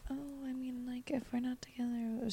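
soft, whispery mock hesitation; meek hedging. Mimicking what she didn't say about the relationship, meek and whispery. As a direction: imitate someone timidly backing off, barely voiced. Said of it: "she wasn't like".

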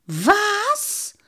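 high-pitched, disbelieving yelp; astonished squawk, sliding up; incredulous cry. A narrator's reading. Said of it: "rief der Lange".

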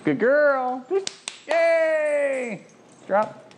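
gushing praise for the dog; excited dog praise. Real, not acted. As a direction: cheer the dog on. Cheering the dog.

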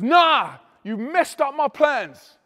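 high, loud, drawn-out complaint; acted-out indignant holler; shouted accusation. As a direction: yell it back over the music, slowly. Girl shouting back beside loud stage.

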